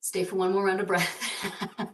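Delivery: soft, laughing repeat of the cue. Real, not acted. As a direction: redo the cue through a giggle, quietly.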